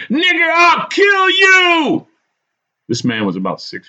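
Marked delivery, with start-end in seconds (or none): 0.1-2.1 slow, high, very loud threat; screaming. A narrator's reading. Madman threatens the man on the balcony, screaming. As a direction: scream the threat, stretching the words. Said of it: "He started screaming again".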